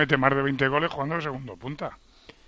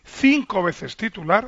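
slow, higher-pitched insistence; drawn-out, pointed repeat for the record; 4 semitones higher, much slower than usual. Real, not acted. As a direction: drive the stat home slowly. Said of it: "Lo está diciendo como en tono".

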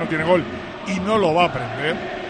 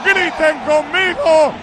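slow, drawn-out celebratory announcing; goal celebration; 10 semitones higher, 5 dB louder, much slower than usual. Real, not acted. Commentator rallies listeners after second goal, drawn-out triumphant calls. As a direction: proclaim the goal phrase by phrase, stretching each ending.

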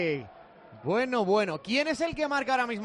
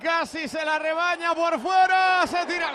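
louder, excited radio commentary; play-by-play; 4 semitones higher, 4 dB louder than usual. Acted. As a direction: call the run as it happens. Live play-by-play of an attack.

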